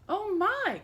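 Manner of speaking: high, loud gasp of fright; startled cry. A narrator's reading. Tiny old woman startled by the lion, high cry. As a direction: squeal it in surprise. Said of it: "cried the tiny old woman".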